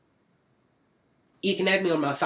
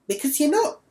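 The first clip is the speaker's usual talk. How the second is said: high loud jab; 12 semitones higher, 4 dB louder than usual. Retold or imitated. Parting jab at owner Sky.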